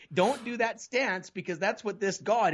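high, slow mock warning voice; preachy warning. Speaker mimics childhood church teaching, high slow mock warning. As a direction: lightly imitate a stern church elder.

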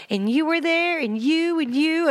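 high imitation of Dorothy waking up; a hammed-up Dorothy, pointing round the room. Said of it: "Okay, Dorothy".

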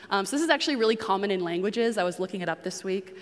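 usual speaking voice

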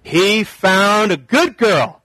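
lightly mocking, drawn-out smug voice; a touch of smug mock approval; mocking wrong view. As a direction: play the naive reader, smug and slow. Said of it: "we don't wanna read this and say".

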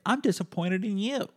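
high-pitched imitated retort; nurse clapping back. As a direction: throw it straight back in a high put-on voice. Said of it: "she clapped back and she was like".

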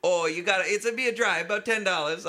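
high, loud mock driver; local driver. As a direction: talk him into the ride. Driver voiced in story.

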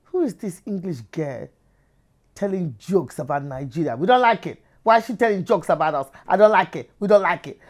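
mock outrage in a Nigerian accent; disapproving Nigerian crowd. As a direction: imitate offended Nigerians objecting to a comic. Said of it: "half the crowd were looking at me like".

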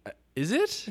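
high timid question; unsure player answering coach; mock timid high question; nervous doubt. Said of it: "and you're like".

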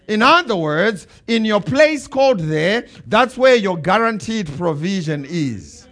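slow, loud, emphatic preaching. Acted. Pastor to his church, slow and loud preaching. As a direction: build it up for the congregation. Preaching, congregation answers amen.

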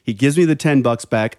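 ordinary talk, nothing marked

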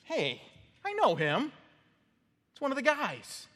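put-on high, pointed accusing girl's voice; servant girl accusing. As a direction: jab it out like a gossiping servant girl. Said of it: "this girl kinda going".

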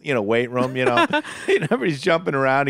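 usual speaking voice

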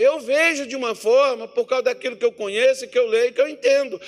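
full-force preaching. Acted.

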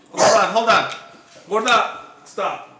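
firm call to dog. Real, not acted. Dog starts acting up.

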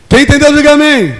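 "Quem entendeu, diga amém" shouted high and loud; preaching shout. Shown as a demonstration. Preacher demands an answer from the congregation, shouting. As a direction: hammer "diga amém" home like a call to the crowd.

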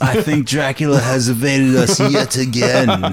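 slow, hammed-up British Dracula voice; mock British accent. Parodying Keanu's Dracula role in a slow mock British voice. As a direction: play it like a stagey Victorian Englishman. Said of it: "his British accent in Bram Stoker's".

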